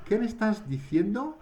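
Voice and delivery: high, surprised "¿Qué me estás diciendo?"; as if you didn't catch what was said. Shown as a demonstration. Teacher demos a surprised question for students. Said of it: "on a la surprise".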